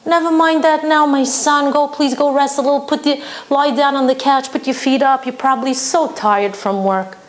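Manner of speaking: high, fussing mother waving off the bulb; mother steering son away from the chore; doting mother. Retold or imitated. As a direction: brush it off and coddle him. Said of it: "She says".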